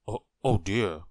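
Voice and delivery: mildly flustered realization; dawning embarrassment. A narrator's reading. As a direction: falter as the mistake sinks in. Realizes his mistake.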